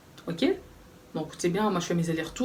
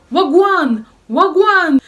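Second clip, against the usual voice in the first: shrill high yelled greeting; retold yell; 10 semitones higher, 12 dB louder, much slower than usual. Jamaican flatmate yelling at the narrator, loud and high. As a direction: bellow it across the flat. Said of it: "elle commence à crier".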